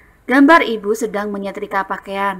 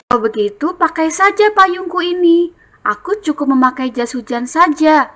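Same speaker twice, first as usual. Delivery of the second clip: higher, generous offer as Benny; reading dialogue; 7 semitones higher, 4 dB louder than usual. Shown as a demonstration. Teacher reading Benny offering his umbrella. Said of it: "Bacanya dengan intonasi yang tepat".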